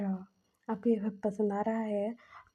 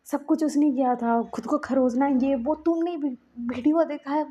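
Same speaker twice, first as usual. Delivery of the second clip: higher and louder, urgent protest; desperate pleading; 5 semitones higher, 8 dB louder than usual. Acted. Myamya pleads with Lajan in the hospital: high, loud, desperate insistence. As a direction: insist loudly that he did it himself.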